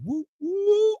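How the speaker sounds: high falsetto whoops for a shout-out; cheer. Real, not acted. Cheering Comedy Elmo on with falsetto whoops. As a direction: whoop it up like a hype man.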